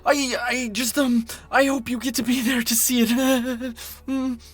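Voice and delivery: high, stammering backpedal with nervous laugh; nervous cover-up. Acted. Caught out by a coworker's question, nervous and high. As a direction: stumble into it high-pitched, then laugh it off.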